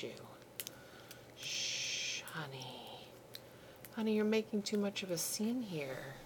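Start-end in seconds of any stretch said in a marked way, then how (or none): none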